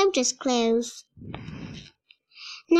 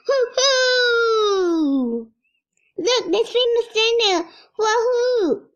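excited cheering; 9 semitones higher, slower than usual. Acted. Villagers cheer the returning hero, excited. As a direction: cheer the hero home at full voice. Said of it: "they say excitedly".

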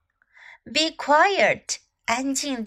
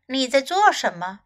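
ordinary talk

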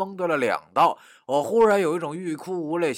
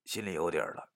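plain reading voice

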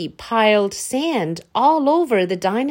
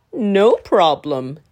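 slow, louder, big dinosaur voice; the dinosaur offers help, slowly and loudly; eager dinosaur; 5 dB louder, slower than usual. Acted. Dinosaur volunteering to fetch water, slow and eager.

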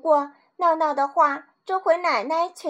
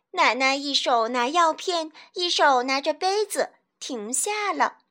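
plain reading voice

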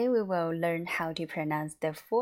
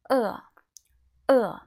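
short "e" falling sharply from high; emphatic falling "e"; fourth tone; 5 semitones higher, 9 dB louder than usual. Shown as a demonstration. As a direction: drop "e" firmly and quickly. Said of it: "This is a fourth tone".

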